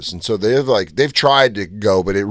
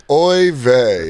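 hammed-up Yiddish Oy vey; yiddish accent; 3 semitones higher, 4 dB louder than usual. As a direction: groan it like a Yiddish grandpa. Jesus on the cross.

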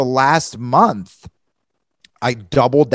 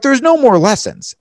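exasperated; 12 semitones higher, 6 dB louder than usual. Retold or imitated. Exasperated retold thought.

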